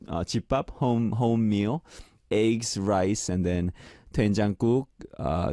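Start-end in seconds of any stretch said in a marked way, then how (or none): none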